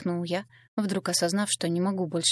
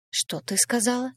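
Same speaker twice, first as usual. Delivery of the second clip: hoarse rasp; 6 semitones higher than usual. A narrator's reading. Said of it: "прохрипел я".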